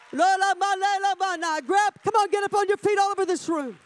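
high, ringing pulpit call; fervent preaching. Real, not acted. Preacher calling congregation onto their feet, fervent preaching. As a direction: rouse the whole room to rise.

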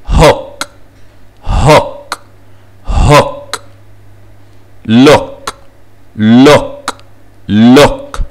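هوك and لوك with a short vowel; short double O. Shown as a demonstration. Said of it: "كلها بتتنطق وان O". (هوك و لوك بحركة قصيرة)

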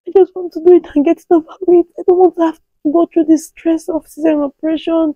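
high, urgent begging through pain; desperate pleading. Retold or imitated. Woman in labour begs her doctor, high desperate pleading. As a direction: plead with the doctor not to cut you. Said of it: "I cried. I begged him".

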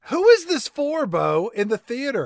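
loud, high incredulous outburst. Real, not acted. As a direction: blurt it out in disbelief.

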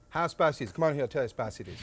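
normal talking voice

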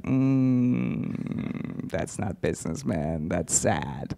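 low, very soft deadpan; quietly deflate his boast in a low voice; mock pitying. Sister lets down crypto bro brother, quiet mock pity.

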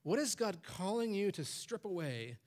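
ordinary delivery

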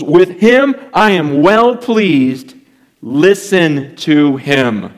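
very slow, booming voice from the cloud; slow, loud and solemn, drawn out; voice of God. Retold or imitated. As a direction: proclaim each word slowly, like God speaking from heaven. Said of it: "they hear a voice come out of the cloud saying".

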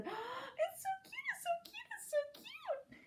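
very high squealy little voice; gushing girls. Acted. Girls admiring a baby rabbit, high gushing squeal. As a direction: coo over it in a tiny high voice.

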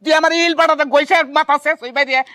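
ordinary talk

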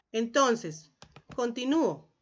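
usual speaking voice

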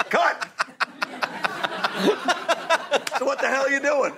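high-pitched yell of a fed-up director; exasperated director. Retold or imitated. Director halts the overacting extra, exasperated.

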